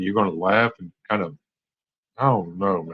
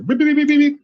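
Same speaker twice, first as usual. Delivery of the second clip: high, steady machine beeps; sung-out robotic beeps; mock computer beeps; 4 dB louder than usual. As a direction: beep like a little computer working. Brain as a beeping computer.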